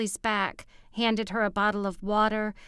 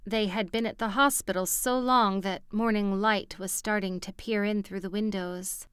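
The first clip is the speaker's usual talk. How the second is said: usual speaking voice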